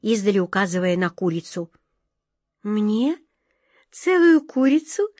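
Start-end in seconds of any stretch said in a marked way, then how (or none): 2.6-5.1 high-pitched, moved disbelief; touched exclamation. A narrator's reading. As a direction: exclaim it high, overcome with gratitude. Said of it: "растроганно воскликнула белка".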